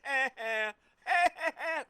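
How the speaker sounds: loud, high villain cackle; mocking laugh. Gloating over the Eagles, a loud high cackle.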